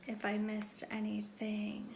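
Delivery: muttered under breath; whispering. Real, not acted. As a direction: whisper it to yourself. Checking notes, muttering to herself.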